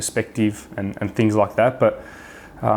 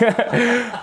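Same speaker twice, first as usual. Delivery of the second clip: high laugh; 13 semitones higher than usual. Real, not acted. Laughing recalling the moment.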